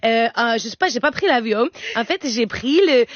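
put-on Spanish-accented French, chatty and playful. Comedian becoming Lola from Saragossa, playful Spanish accent. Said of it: "je change de nationalité".

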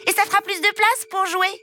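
put-on high, whiny child voice listing wishes; a spoiled little prince insisting; demanding child prince. Spoiled prince demanding everything from his parents, high and whiny.

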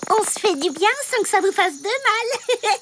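ordinary talk

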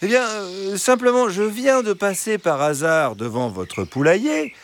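high, smooth fox explaining. A narrator's reading. Fox explaining to the hens, high and smooth. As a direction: spin a casual excuse sweetly.

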